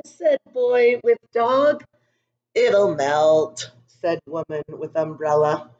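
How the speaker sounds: put-on calm storybook narration for young kids; story reading. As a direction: read it gently to a class of children. Reads picture book to class.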